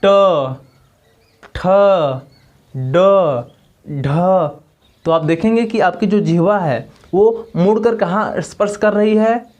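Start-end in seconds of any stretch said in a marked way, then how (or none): 0.1-4.1 ट, ठ, ड, ढ each said alone, tongue curled back; teacher demonstrating ट ठ ड ढ; demo of retroflex sounds. Shown as a demonstration. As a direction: sound out ट ठ ड ढ slowly with pauses. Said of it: "इसको बोलिए आप लोग".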